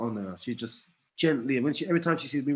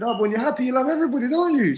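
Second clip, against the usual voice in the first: much higher, sing-song coaxing; teasing, high-pitched persuasion; playful coaxing; 12 semitones higher, 6 dB louder, faster than usual. Retold or imitated. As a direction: lead the child gently to the answer. Coaxing his daughter playfully.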